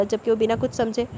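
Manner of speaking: plain reading voice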